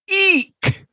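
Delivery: very high shrieked eek; high squeal. Acted. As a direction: squeal in disgust. Reading review exclamation.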